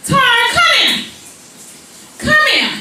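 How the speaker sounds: high-pitched, stern call; short, high summons from mom; mother summoning her child. Retold or imitated. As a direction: call the child over sharply.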